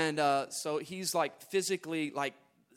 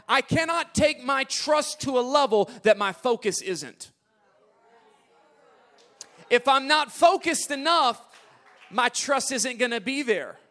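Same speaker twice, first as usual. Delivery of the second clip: climactic preaching; 7 semitones higher, 7 dB louder than usual. Acted.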